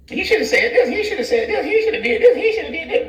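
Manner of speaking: high sing-song hater voice; mocking haters. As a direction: parody the nitpicking haters.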